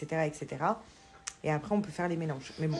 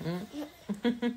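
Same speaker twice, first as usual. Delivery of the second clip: ordinary delivery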